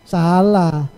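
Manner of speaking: SALAH low and falling, like a verdict; misleading intonation. Shown as a demonstration. Host testing listeners with an antonym, misleading falling intonation. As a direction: say SALAH as a low, final ruling. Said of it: "intonasi yang kurang tepat".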